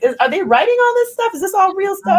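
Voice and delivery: playing her own naive shock; incredulous. Rapper retelling her doubt about songwriting, incredulous. Retold reaction to pop rappers.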